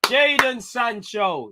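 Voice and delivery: building from loud commentary to a long, high shout; excited goal call. Real, not acted. Commentator calls Jadon's goal to viewers, excited shout building to the peak. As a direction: stretch the scorer's name out, high and roaring.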